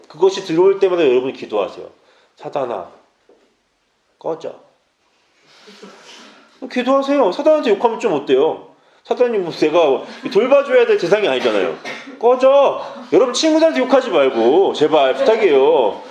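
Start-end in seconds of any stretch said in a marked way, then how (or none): 2.4-4.6 조용하고 낮게 내뱉는 명령; 소리 높이지 않고 나직이 물리치듯; 낮게 쫓아내기. Shown as a demonstration. 사단을 쫓는 말을 시연. 12.2-12.8 high, forceful dismissal; 쫓아내는 명령. Shown as a demonstration. 사단에게 욕하는 시연.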